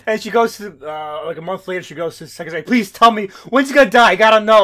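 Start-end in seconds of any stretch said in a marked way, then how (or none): 2.6-4.6 higher, louder exasperated begging; desperate plea. Acted. Wife begging the secretary for an answer, hammed-up desperate plea. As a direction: plead as if at the end of your rope.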